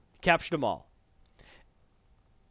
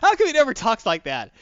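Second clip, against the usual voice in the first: ordinary talk, nothing marked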